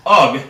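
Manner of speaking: short grunted ugh; the sound ugh on its own. Shown as a demonstration. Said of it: "the U G sound, which is ugh".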